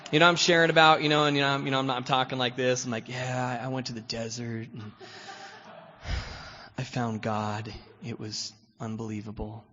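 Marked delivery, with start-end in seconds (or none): 3.1-9.6 lightly hushed, put-on holy calm; mock soft-spoken spiritual voice. Newcomer at meetings faking spiritual talk, mildly soft-spoken. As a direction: imitate a gentle spiritual guy sharing, only a touch softer. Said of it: "I'm talking really soft-spoken 'cause that's how spiritual people talk".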